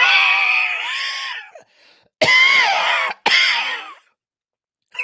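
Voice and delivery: hoarse, very high shouted croak; rough strange voice. Acted. Villagers struggling to greet the team, rough screeching voice. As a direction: strain every word into a loud, absurd screech. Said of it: "rough voices that need lozenges".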